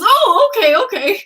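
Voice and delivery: high, loud okay cracking with laughter; laughing okay. Retold or imitated. Fan quoting Clutterbuck, laughing okay. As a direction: giggle through the okays. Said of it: "And he goes".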